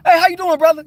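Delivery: loud, high-pitched hello; over-the-top cheery shout; exuberant greeting. Retold or imitated. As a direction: call out a big friendly greeting. Acts out greeting in store.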